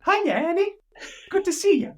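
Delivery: very high squeaky cartoon mouse; Mickey Mouse voice. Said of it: "That's Mickey Mouse".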